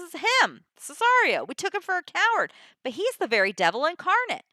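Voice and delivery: high-pitched, mildly hammed-up finger-pointing; mock accusation. Andrew points out Cesario as his attacker, high mock accusation.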